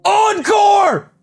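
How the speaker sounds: high-pitched shouted call; crowd-style shout. Pretend. Fan begging for an encore, high shout.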